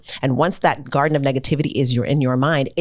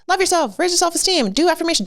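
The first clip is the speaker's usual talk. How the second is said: much higher, sing-song list of slogans; mock preachy lecture. Mocking the old way of telling kids to love themselves, high sing-song. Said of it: "So I'm not just".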